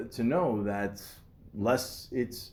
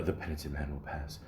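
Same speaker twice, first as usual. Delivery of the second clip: low, hushed murmur of the riddle; quoting Indiana Jones, quiet and reverent; hushed movie quote; 7 semitones lower, 11 dB quieter than usual. Retold or imitated. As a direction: breathe the line like a sacred chant. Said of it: "Indiana Jones and Last Crusade".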